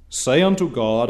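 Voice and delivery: ordinary talk, nothing marked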